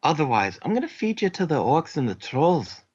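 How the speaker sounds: light Scottish interrogator voice; Scottish accent. Acted. Dwarf threatening the prisoner in a mild Scottish accent. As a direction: lean into the brogue, menacing. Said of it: "I am so sorry I cannot stick with this accent".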